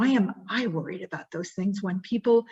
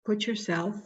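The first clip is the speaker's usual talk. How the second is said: guided reflection; 6 dB quieter than usual. Acted. Speaker guiding the audience back to a painful moment, softly. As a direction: gently lead them back into the moment.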